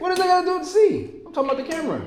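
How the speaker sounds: exasperated. Real, not acted. Exasperated at misunderstanding friend.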